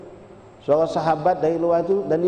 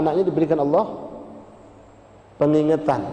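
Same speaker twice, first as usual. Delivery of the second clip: ordinary talk, nothing marked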